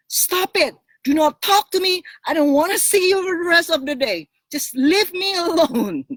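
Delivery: high, loud outburst at a partner; retold angry shout. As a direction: shout it, high-pitched and fed up. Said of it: "I would just shout".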